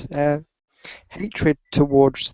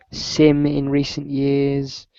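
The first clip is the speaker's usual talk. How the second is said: ordinary talk, nothing marked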